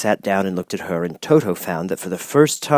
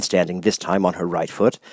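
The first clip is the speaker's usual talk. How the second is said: ordinary delivery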